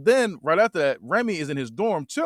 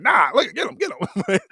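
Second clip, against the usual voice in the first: louder, higher barking orders; mock cop shout; 8 semitones higher, 4 dB louder than usual. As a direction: yell like a cop grabbing him. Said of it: "the police like".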